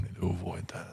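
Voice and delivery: whispered reminder to himself. Real, not acted. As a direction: breathe it out as a private warning.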